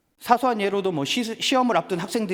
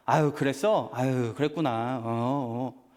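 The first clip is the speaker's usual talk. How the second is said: half-hearted sympathy; 5 semitones lower, slower than usual. Retold or imitated. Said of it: "어떻게 반응을 할까요?".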